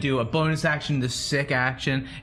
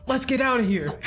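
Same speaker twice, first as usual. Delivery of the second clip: put-on, much higher character voice; 11 semitones higher than usual. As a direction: pitch it well up, in character. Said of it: "I can use my character voice".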